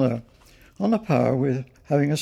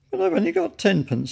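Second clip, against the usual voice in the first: small, much higher, sad little voice; a touch of sorrow, pleading; sadly; 14 semitones higher than usual. A narrator's reading. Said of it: "said Paddington sadly".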